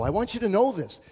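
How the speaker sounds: ordinary talk, nothing marked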